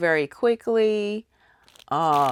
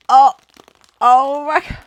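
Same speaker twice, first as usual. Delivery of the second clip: excited gasp; 9 semitones higher, 11 dB louder than usual. Real, not acted. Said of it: "I gotta catch my breath".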